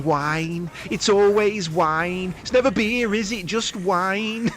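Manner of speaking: high, slow exasperated Lister voice; Dave Lister impression. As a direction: whine it like a fed-up Lister. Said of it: "Dave Lister going".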